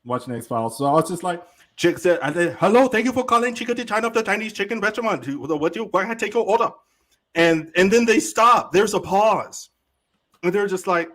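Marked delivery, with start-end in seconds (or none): 2.6-6.7 pitched-up, hammed-up Chinese accent on a prank call; mock Chinese accent. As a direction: answer like a fake Chinese takeout clerk, higher. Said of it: "Oh, that's so racist".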